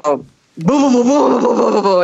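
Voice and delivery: rolling, repeated booms; thunder imitation. Narrator mimicking the storm coming back, rolling thunder booms. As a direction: imitate rumbling thunder with your voice. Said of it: "the thunder starts".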